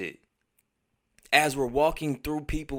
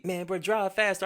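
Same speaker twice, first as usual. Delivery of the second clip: higher mock demand; imitating friend yelling; 8 semitones higher than usual. Friend in the passenger seat demanding speed, mock yell. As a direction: ham up the pushy passenger. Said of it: "Now he's yelling at me".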